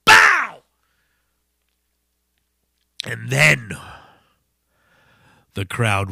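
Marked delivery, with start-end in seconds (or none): 0.0-0.6 high, loud punchy sound effect; kick impact noise. Retold or imitated. Showing the singer's kick to the face, loud impact noise.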